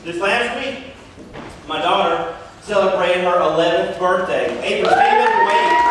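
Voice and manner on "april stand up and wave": calling out. Real, not acted. Calling to daughter in the crowd.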